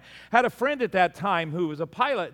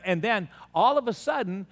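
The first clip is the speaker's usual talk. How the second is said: usual speaking voice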